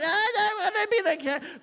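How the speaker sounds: high mock-crying tantrum; wailing child. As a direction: wail through the words like a toddler. Voices child refusing food.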